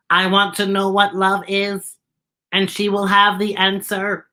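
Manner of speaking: even-pitched, word-by-word robot voice; monotone robot Z. Acted. Robot explaining his quest for love, in an even monotone. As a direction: speak like a polite robot on one note.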